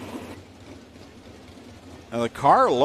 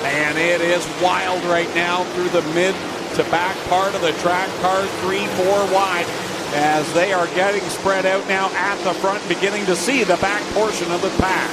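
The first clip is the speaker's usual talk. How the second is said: motorsport commentator; 4 semitones higher than usual. Real, not acted. Live race call.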